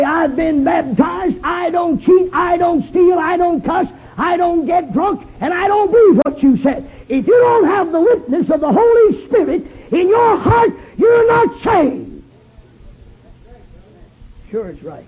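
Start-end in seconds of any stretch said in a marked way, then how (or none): 0.0-6.9 high shouted imitation of a smug churchgoer; mock churchgoer. Preacher mocking a self-righteous churchgoer, shouting. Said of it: "You say, "Preacher". 7.1-12.2 high, slow shouted climax; preaching at full force. Acted. Preacher crying out the truth to the crowd, high and slow.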